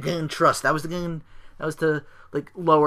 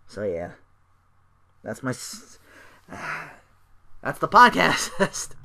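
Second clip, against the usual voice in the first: slow sign-off; 3 semitones higher, slower than usual. Real, not acted. Weary sign-off.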